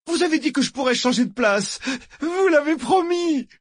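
very high, petulant whine; whining like a brat. A narrator's reading. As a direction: wail it like a spoiled child. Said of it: "Sa voix était aussi géniarde que celle d'un gosse capricieux". (geignard comme un gosse capricieux)

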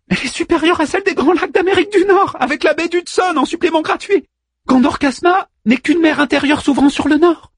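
very high, eager little figure; Manmut's excited voice. A narrator's reading. Manmut tells Orpheus they have room, high excitement. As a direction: rattle off the good news at high pitch.

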